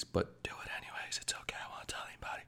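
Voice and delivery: secretive whisper to the viewers; mock-secret whispered aside. Urging viewers to sneak snacks.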